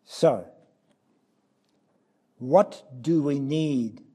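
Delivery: ordinary delivery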